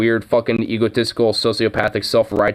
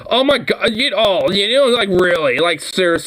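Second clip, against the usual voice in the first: incredulous exasperation; 8 semitones higher than usual. Real, not acted. Reacting to the anchor's speech, incredulous and exasperated. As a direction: pitch jumps up in outraged disbelief.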